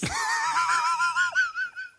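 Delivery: long, very high laughing squeal; real squeal of laughter. Real, not acted. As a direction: explode into high-pitched laughter. Bursts out laughing at mock threat.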